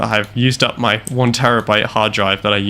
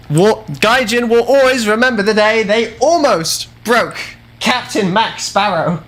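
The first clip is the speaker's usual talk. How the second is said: high, slow, put-on epic announcer voice; grandiose boast; 10 semitones higher, 3 dB louder than usual. Gamer boasts about himself to the developers, grandiose slow proclamation. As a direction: proclaim it like a legend's title card.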